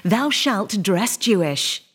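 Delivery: usual speaking voice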